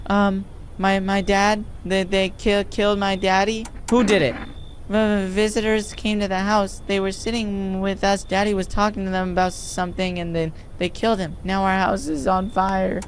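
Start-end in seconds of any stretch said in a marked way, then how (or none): 0.1-3.7 higher-pitched, halting little voice; lightly acted distressed daughter; frightened daughter. 4.9-13.0 put-on higher, halting child's voice; acted small, shaken voice; frightened daughter.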